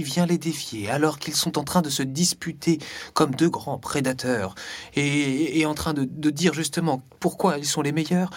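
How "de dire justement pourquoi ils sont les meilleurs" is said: ordinary delivery, nothing marked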